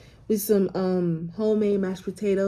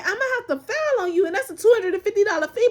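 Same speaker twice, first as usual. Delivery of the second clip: higher, fast agent warning of a fee; leasing agent voice; 10 semitones higher, faster than usual. Retold or imitated. Leasing agent warning of the filing fee, high and fast. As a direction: rattle off the fee, pitched up. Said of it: "She said".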